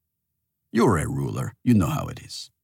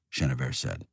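ordinary delivery, nothing marked

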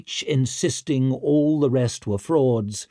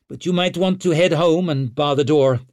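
loud, higher-pitched advice; raised-voice warning; 5 semitones higher, 5 dB louder than usual. A narrator's reading. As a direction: call out a brisk warning. Rescuer warns the man she saved.

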